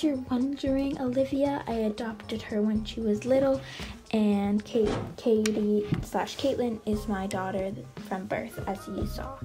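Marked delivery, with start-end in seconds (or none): none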